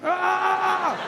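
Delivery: long, high, loud yell; frustrated scream. Retold or imitated. Phone owner giving up on the assistant, long frustrated scream. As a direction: scream out the exasperation. Exasperated with Siri.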